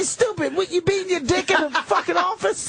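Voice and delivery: high mock yell; retold yell at Patrice. Telling off a coworker caught in the office, high yell. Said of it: "I say, I say".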